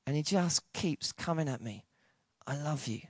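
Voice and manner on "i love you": very soft declaration; tender. Retold or imitated. God still coming at the preacher, tender. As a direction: answer the outburst with hushed gentleness.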